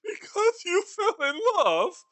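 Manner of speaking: mocking disbelief. Scrooge mocks nephew's reason.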